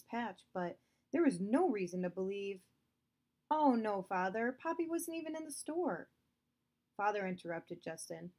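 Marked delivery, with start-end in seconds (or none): 3.5-6.1 put-on higher, pleading boy; anxious protest. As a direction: rush to defend Poppy. Justin protests to father.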